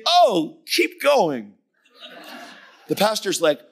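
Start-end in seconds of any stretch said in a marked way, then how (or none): 0.1-1.6 much higher, laughing invitation; laughing egging on. Retold or imitated. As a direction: dare him gleefully to go on. Preacher eggs on angry man.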